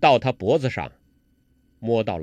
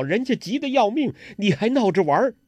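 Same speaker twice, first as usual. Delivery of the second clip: high-pitched, fretful scolding; indignant, high-voiced protest; stamping exasperation; 7 semitones higher than usual. A narrator's reading. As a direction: scold him like an exasperated sweetheart. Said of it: "穆念慈直跺脚".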